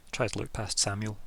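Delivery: ordinary talk, nothing marked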